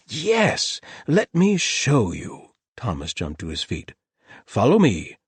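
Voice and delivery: high, loud and slow eager; can't wait to show it; excited. A narrator's reading. The host leading the trio to his discovery, excited.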